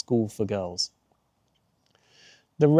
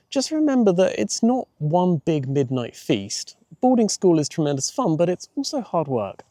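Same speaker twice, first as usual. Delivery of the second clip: a lightly raised, kindly mother; gently lifted voice of a caring mum; light motherly advice, pitched up; mum's voice; 6 semitones higher than usual. A narrator's reading.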